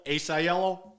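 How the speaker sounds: ordinary delivery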